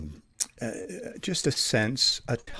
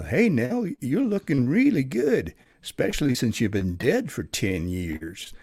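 cowboy; 4 semitones higher than usual. Shown as a demonstration. Cowboy greeting long-dead Nell in a ghost story, cowboy voice. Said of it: "I was a cowboy".

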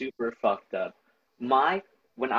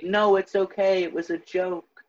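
higher-pitched imitation of a backtracking mom; hurriedly soothing, pitched up; mock reassurance; 7 semitones higher than usual. Mom calming her sobbing child after a joke, mock reassurance.

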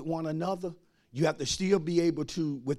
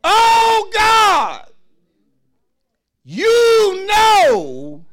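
high, loud preaching cry; 10 dB louder, much slower than usual. Acted.

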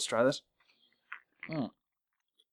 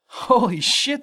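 surprised; 10 semitones higher, 5 dB louder, much slower than usual. Real, not acted. Amazed by fancy beer.

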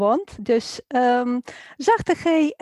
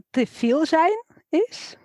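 normal talking voice

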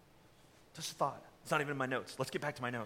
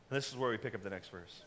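normal talking voice

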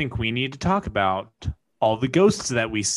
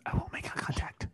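quick hushed whisper; 11 dB quieter, faster than usual. Pretend. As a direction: whisper nervously. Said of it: "why are we whispering".

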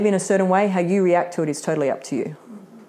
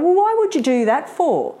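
high-pitched mock indignant challenge; retold fiery temper; 10 semitones higher than usual. Imitating how she used to react to people, fired up. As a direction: act out firing up, pitch jumping high. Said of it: "he would fire up quickly".

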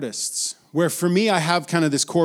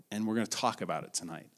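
ordinary talk

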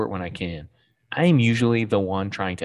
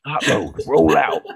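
mock Autobot leader; 3 semitones higher, 4 dB louder than usual. Playing Autobot leader with joke name.

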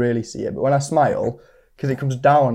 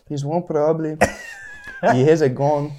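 mock-Mexican cartoon accent; playful cartoon Mexican delivery. Shown as a demonstration. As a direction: repeat the bit in a put-on Mexican accent. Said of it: "You do it one more time".

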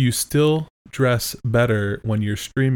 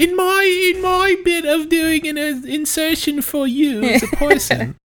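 stays in a much higher falsetto while explaining; impression of co-host; 5 dB louder than usual. Co-host still in high falsetto impression of her. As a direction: keep the squeaky mock voice going. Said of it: "I do you like this".

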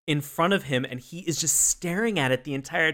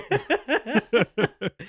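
high laugh; 10 semitones higher, 3 dB louder than usual. Real, not acted. Laughing at teen moms joke.

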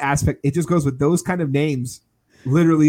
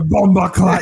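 mock Jamaican; 3 semitones higher, 4 dB louder than usual. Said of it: "It's more like Jamaican".

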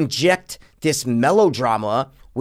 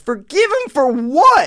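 higher, louder, slow incredulous retort; arguing with the movie daughter, exasperated and higher; exasperated disbelief; 12 semitones higher, 4 dB louder, slower than usual. Retold or imitated. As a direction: throw it back in total disbelief. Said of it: "I'm like".

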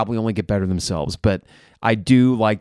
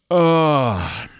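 a short, higher disgusted groan; pained fan groan at a missed rebound; guttural groan; 6 semitones higher, 3 dB louder than usual. Real, not acted. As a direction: let out a fed-up guttural grunt. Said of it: "that guttural reaction from me".

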